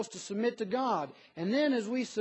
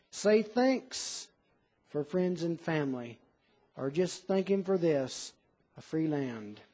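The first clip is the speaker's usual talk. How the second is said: poem reading; 4 semitones lower than usual. Acted. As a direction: read the verses gently, like a hymn. Preacher reads a poem aloud.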